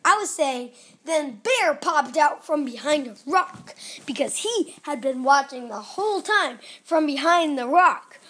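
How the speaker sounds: ordinary delivery, nothing marked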